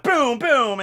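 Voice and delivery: loud, high-pitched booms; imitated impact. Fan describes a fighter sent flying, loud cartoon impact booms. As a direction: punch out each boom like a cartoon hit. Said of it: "he's gonna be like".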